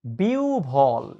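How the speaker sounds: "বিউভল" very slow, bi-u-bhol with a breathy bh; correct pronunciation. Shown as a demonstration. Teacher giving students the right form, "বিউভল" stretched slowly as bi-u-bhol. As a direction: draw out "বিউভল" as bi-u, then bhol. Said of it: "এটার উচ্চারণ হচ্ছে".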